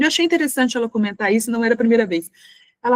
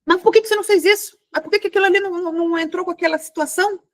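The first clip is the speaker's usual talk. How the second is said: higher, fast, exasperated questioning; mock irritated boss; 8 semitones higher, much faster than usual. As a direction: fire the questions at the employee in an annoyed rush, pitched up. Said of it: "evitar falar com ele assim". (chefe impaciente cobrando o funcionário, rápido e agudo)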